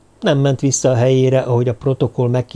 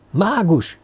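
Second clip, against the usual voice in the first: higher, held-back call for help; stifled cry; 7 semitones higher than usual. A narrator's reading. Kígyó running to the platform, stifled cry. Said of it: "kiáltotta elfojtó hangon".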